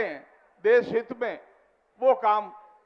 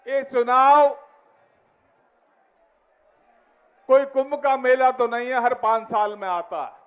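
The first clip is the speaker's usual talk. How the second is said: booming political rally speech; 4 dB louder than usual. Real, not acted. Addressing rally crowd.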